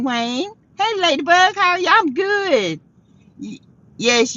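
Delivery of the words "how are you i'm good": ordinary talk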